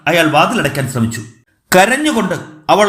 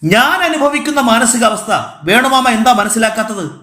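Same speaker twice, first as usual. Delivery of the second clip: high-pitched, aggrieved outburst; agitated protest; 7 semitones higher than usual. A narrator's reading. Man asks uncle to understand his state, agitated. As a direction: plead your own case heatedly.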